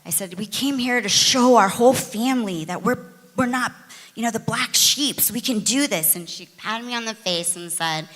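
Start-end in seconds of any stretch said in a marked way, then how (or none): 0.4-6.1 retold upset plea, pitched higher and louder; upset protest. Daughter pleading with mother about the family, upset. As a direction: make an upset case, voice lifted. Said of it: "I was, like, really upset".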